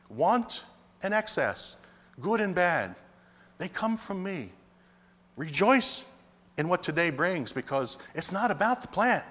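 ordinary delivery

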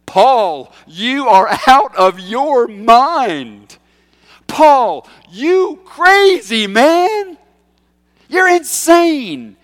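high, loud, slow outburst at Paul; Festus shouting. Acted. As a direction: bellow it out, drawing each word long. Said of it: "Festus says in a loud voice".

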